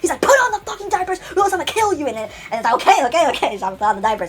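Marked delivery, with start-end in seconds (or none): 0.2-2.1 high-pitched acted yelling; angry yelled threat. Character threatens him, acted out. 2.8-3.6 high, loud acted surrender; flustered giving in. Giving in to the threat.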